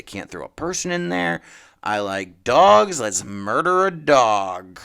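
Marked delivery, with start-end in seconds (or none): 1.8-4.8 slow, louder deadpan mockery; slow, loud parody of lazy logic; mock callous writer. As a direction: spell it out slowly like a heartless writer. Imagining the writer's callous reasoning.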